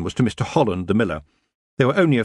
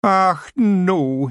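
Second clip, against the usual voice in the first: high, louder Scots wail; Scottish wail; 9 semitones higher, 4 dB louder than usual. Acted. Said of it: "he wailed".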